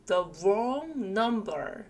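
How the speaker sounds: very slow with wrong drawn out; slow model phrase. Shown as a demonstration. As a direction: hold each word for learners. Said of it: "wrong이라는 이 단어는요". (아주 천천히 또박또박)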